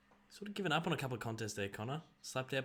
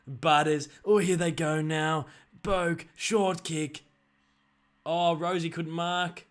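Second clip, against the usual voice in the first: louder, slower play-by-play call; excited commentary; 4 semitones higher, 8 dB louder, slower than usual. Pretend. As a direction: lift as the play opens up. Mock commentary, excited passage.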